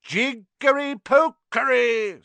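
high, loud, slow mock spell; drawn-out, menacing magic words; fierce incantation. A narrator's reading. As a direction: chant it fiercely to scare him. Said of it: "said Harry in a fierce voice".

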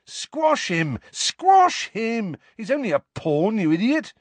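high, excited urging; enthusiastic egging on. A narrator's reading. Harry egging on his chess castle, enthusiastic. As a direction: egg on your fighter, pitch leaping up. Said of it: "he was egging it on enthusiastically".

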